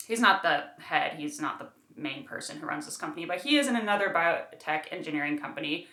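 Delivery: usual speaking voice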